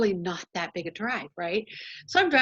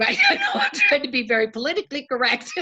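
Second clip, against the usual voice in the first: words laughed through, fast and higher; laughing; 8 semitones higher, faster than usual. Real, not acted. Speaker to group, laughing at her own judgmental thought. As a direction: giggle through the admission.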